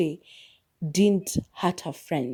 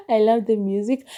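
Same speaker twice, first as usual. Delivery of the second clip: higher and louder, gushing about the music; excited; 5 semitones higher, 5 dB louder than usual. Real, not acted. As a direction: burst out with delight over the music. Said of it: "This time I remember to listen to the music".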